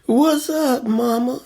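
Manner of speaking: high mock smooth-talker voice; hammed-up flirty street greeting; phony pickup line. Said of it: "you ain't gotta do all that".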